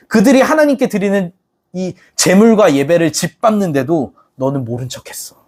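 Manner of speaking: ordinary talk, nothing marked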